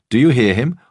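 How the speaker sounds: loud sneering weightlifter; jeering thug. A narrator's reading. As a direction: play to your mates, mocking the owner.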